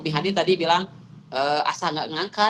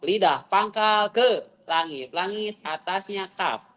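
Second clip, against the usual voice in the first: slow explaining; 3 semitones higher, slower than usual. Shown as a demonstration. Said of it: "Menyebutnya juga kof".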